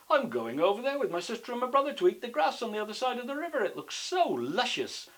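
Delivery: high, rushed goat explanation; eager goat character. Acted. Big goat explains to troll.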